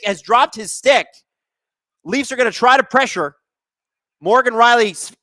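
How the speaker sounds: normal talking voice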